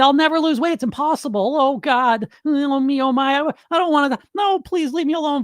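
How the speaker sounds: high, pleading self-pity; whiny inner voice. Retold or imitated. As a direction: whimper it like a scared child. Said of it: "My little voice in my head just said".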